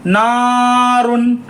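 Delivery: very slow नारुन, drawn out; slow recitation. Shown as a demonstration. Teacher demonstrating to learners, slow chant. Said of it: "तो कैसे पढ़ेंगे".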